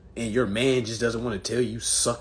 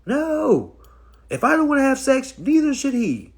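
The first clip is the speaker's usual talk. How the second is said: put-on high, loud squealing girlfriend; hammed-up disgusted woman; mock disgusted woman; 7 dB louder than usual. Mocking women refusing sex, high loud squeal. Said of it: "They be like".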